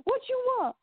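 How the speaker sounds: high, aggressive mock challenge; hammed-up drunk brawler; what you want, what you want; imitating gruff Howard. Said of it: "Howard is very gruff".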